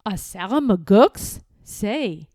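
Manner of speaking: usual speaking voice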